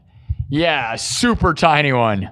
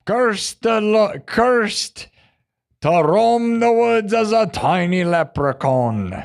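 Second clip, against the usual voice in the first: dramatic narrator; 2 semitones higher, slower than usual. Acted. Dramatic curse pronounced on leprechaun.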